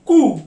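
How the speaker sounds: कू pushed from the throat; throaty ku. Shown as a demonstration. Teacher modelling throat sound कू. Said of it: "गले से sound आती है खू".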